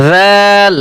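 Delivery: জাল with a soft Arabic 'dh', held long; slow, sustained জাল with a soft consonant; soft dhal. Shown as a demonstration. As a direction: draw out জাল gently on the opening sound. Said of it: "জাল হরফ নরম".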